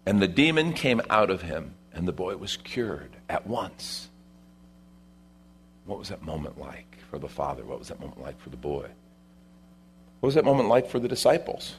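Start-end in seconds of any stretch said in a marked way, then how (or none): 5.8-8.9 very soft, low, pondering questions; hushed reflection. Real, not acted. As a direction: drop right down and let them ponder. Preacher invites hushed reflection.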